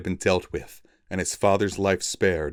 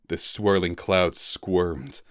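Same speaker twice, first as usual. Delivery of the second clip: ordinary delivery, nothing marked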